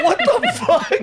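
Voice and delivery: high-pitched mock outrage; laughing, incredulous high outburst; retold disbelief. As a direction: squeal it in comic disbelief. Retold diner's shock at fake food.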